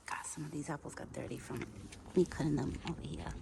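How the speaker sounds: unvoiced, soft whisper. Real, not acted. Owner whispering beside her feeding pet. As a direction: whisper so he isn't scared. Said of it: "whispering near the animal".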